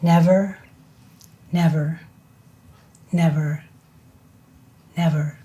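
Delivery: slow, grave Shakespeare reading; dramatic recitation. Acted. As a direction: recite it like a tragic actor. Recites King Lear.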